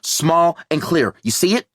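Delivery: tense call; urgent instruction. Acted. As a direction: spell it out over the panic. Linda directing Dave urgently.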